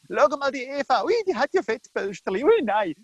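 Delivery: gossipy, squealy put-down; mocking ridicule. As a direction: make fun of someone behind their back. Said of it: "übereinander lächerlich machen".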